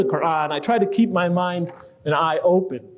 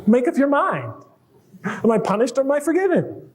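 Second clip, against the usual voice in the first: higher, a lightly voiced indignant child; daughter protesting; 7 semitones higher than usual. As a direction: argue like a cheated kid. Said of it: "they'd say to me".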